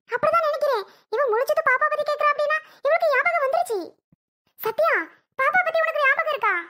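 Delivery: high-pitched, amazed disbelief; astonished. Acted. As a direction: react as if a memory has miraculously returned. Astonished that patient's memory has returned.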